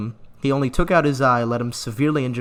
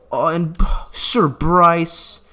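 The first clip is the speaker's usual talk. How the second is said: mock grief; 4 semitones higher, 6 dB louder, much slower than usual. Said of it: "I'm gonna keep doing my bit".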